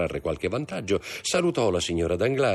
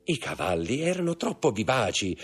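higher-pitched excuse-making; defensive husband; 8 semitones higher than usual. Acted. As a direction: plead your case in a raised voice. Danglars answers his wife defensively.

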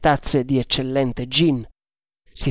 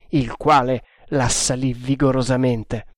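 ordinary delivery

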